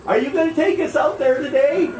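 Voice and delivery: put-on a hopeful old lady pleading; lightly voiced old woman begging a boat ride; eager old woman. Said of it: "she just looks at me".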